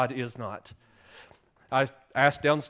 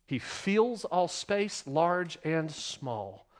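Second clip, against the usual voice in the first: slow emphasis; 3 dB quieter, much slower than usual. Real, not acted. Slow emphatic sermon point.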